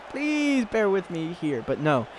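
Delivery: normal talking voice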